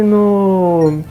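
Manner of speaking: ordinary talk, nothing marked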